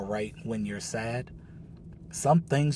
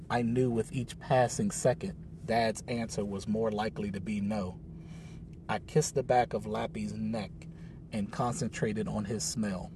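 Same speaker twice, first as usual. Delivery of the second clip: ordinary delivery, nothing marked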